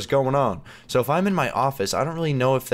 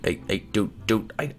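ordinary talk

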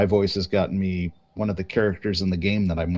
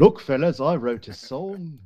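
higher mock Liverpool drummer, last words drawn out; 6 semitones higher, slower than usual. Drummer presenting his song to bandmates, hammed-up Liverpool voice. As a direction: play a hopeful drummer in a put-on Liverpool accent. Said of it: "My name's [name]".

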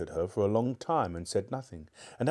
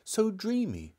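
uneasy; 11 semitones higher than usual. Acted. Polly uneasy, high and slow.

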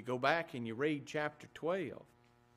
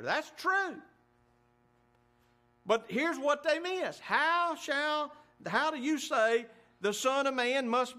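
preaching at full force; 9 semitones higher, 4 dB louder than usual. Real, not acted. Preacher rising to a peak.

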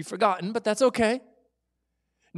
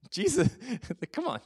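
laughing through the words; amused chuckle while speaking; 5 semitones higher than usual. Real, not acted. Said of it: "This is humorous, really".

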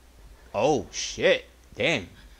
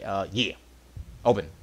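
ordinary talk, nothing marked

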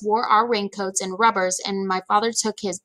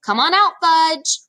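higher, louder sing-song call; calling a dog; 8 semitones higher, 5 dB louder, slower than usual. A narrator's reading. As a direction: call him like a lost puppy. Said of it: "I sounded like I was calling a dog".

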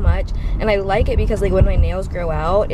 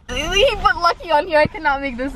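high giggly amusement; laughing; 9 semitones higher than usual. Real, not acted. Vlogger showing the Lucky label, laughing. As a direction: laugh while reading the cup.

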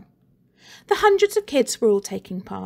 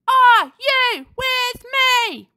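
high, loud and slow rallying call; rallying cry; 5 dB louder, much slower than usual. A narrator's reading. Girl makes her final call to the crowd, rallying. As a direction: drag it out to rally them.

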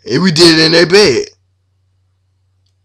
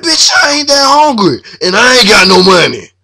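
high, louder comic yell; mock yell; 8 semitones higher, 3 dB louder than usual. Yelling at his girlfriend at the gas station, high mock shout. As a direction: snap at her, voice jumping way up.

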